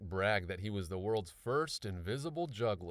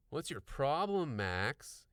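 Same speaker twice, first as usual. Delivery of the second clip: demanding; 7 semitones higher, slower than usual. A narrator's reading. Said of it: "he demanded".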